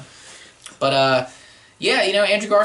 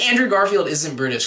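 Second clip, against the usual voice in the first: ordinary delivery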